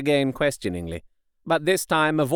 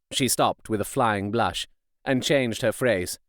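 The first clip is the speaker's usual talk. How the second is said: plain reading voice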